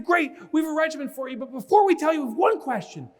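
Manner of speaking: high, excited announcement; hammed-up motivator; acted eager researcher. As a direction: keep the hype up, pitched high. Hyped researcher replying.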